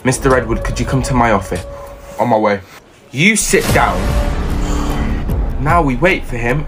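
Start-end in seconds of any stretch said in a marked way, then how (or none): none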